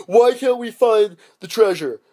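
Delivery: loud, high, slowed complaint; hammed-up exasperated pirate; mock frustration. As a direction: wail the question slowly. Pirates frustrated in retold plot.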